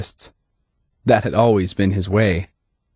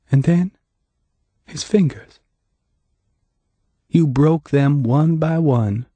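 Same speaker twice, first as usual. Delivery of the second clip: slow, higher, sorrowful; sad telling; 6 semitones higher, slower than usual. A narrator's reading. Hannah recounting the beating, with sadness. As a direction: lay it out with sadness, not blame. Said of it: "only sadness".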